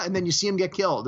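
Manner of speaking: usual speaking voice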